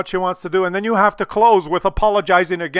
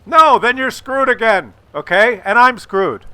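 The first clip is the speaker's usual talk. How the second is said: higher, louder scolding warning; emphatic warning; 6 semitones higher, 7 dB louder than usual. Real, not acted. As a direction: shout the warning.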